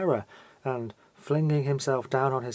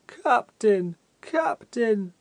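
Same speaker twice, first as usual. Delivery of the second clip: higher, louder, more pathetic wailing call; a blank-faced man pleading even more piteously; piteous cry; 7 semitones higher, 3 dB louder, much slower than usual. A narrator's reading. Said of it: "with increased pathos in his voice".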